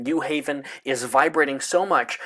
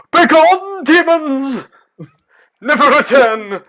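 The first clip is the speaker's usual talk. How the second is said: very high, loud theatrical shriek; hammed-up exorcist cry; dramatic banishing shout; 13 semitones higher, 11 dB louder than usual. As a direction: cast them out like a mad seer. Trelawney shouts at birds.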